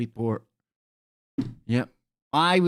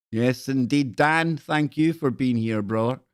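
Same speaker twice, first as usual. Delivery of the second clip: ordinary talk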